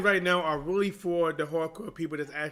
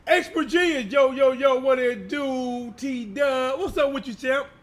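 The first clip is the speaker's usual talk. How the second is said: boxing ring announcer; 10 semitones higher, 7 dB louder, slower than usual. Acted. Hype greeting to a viewer.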